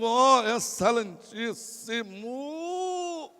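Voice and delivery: very drawn-out mock-holy address; pompous son. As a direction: stretch it like an overdone prayer. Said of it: "Imagina ele entrando, dizendo". (súplica pomposa e arrastada, de gozação)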